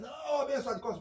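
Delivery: mock inmate urging him closer; insistent call.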